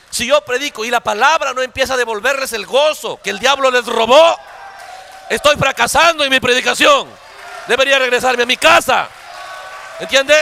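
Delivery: impassioned preacher's proclamation; fervent preaching. Real, not acted. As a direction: drive each phrase out to the congregation. Preaches quote at full force.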